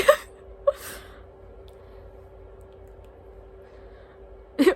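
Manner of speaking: a high, breathy sob that breaks into laughter; crying laugh. Real, not acted. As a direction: cry and laugh at once. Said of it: "I don't know why I'm crying".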